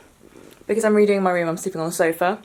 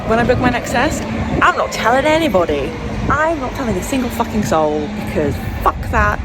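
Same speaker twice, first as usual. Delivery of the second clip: louder, firm and defiant; loud defiant vow; 5 semitones higher, 5 dB louder than usual. Real, not acted. Vlogger telling viewers she won't tell anyone, defiant. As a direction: declare it like swearing an oath. Vowing to keep next test secret.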